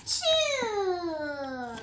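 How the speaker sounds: a loud sneeze burst out; acted sneeze. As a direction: let the sneeze explode. Elephant sneezes.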